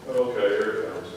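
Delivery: tired dad braces for his son's question, low and soft; weary mutter. Retold or imitated. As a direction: sigh it out, bracing yourself.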